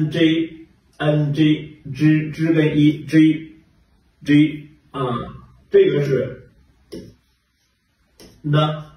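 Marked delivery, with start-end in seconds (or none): 0.1-4.7 "G" said very slowly as a separate drawn-out sound, repeated; slow teacher drill of "G"; sounding out letters. Shown as a demonstration. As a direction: spell out "N G" and "G" slowly, one sound at a time like a teacher. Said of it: "这个是发E，N".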